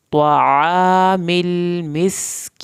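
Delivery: stretched tajweed recitation; Quran recitation. Shown as a demonstration. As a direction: chant it out slowly with a full heavy ṭā. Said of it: "طوا کو پر کرنا ہے".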